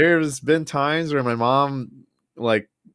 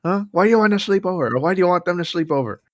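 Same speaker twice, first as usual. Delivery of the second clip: high, fast nagging mom voice; mock mom interrogation; imitating mom; 7 semitones higher, faster than usual. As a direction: quiz him like a suspicious mother. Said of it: "she would just be like".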